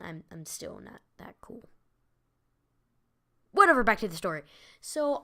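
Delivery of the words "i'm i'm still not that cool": whispered aside. Real, not acted. Self-deprecating aside under breath.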